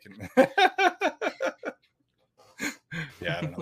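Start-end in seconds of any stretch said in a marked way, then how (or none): 0.3-1.7 sudden high-pitched giggle; high laugh. Real, not acted. Laughs at own comment.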